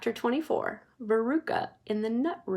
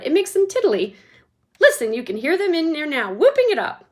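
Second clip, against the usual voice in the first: gleeful; 5 semitones higher, 7 dB louder than usual. Acted. Wonka gleeful.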